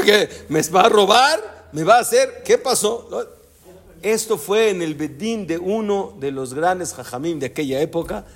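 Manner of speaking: usual speaking voice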